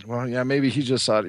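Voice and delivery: ordinary delivery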